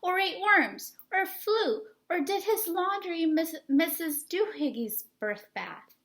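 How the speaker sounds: mother scolding her kitten son. Acted. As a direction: scold him like a fed-up mom. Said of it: "she scolded".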